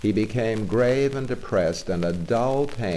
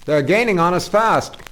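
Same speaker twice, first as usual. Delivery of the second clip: high, loud urgent cry; 11 semitones higher, 8 dB louder than usual. Acted. Engine driver crying out to Toad about the pursuers, urgent. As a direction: shout the warning over the engine noise. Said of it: "cried the engine driver".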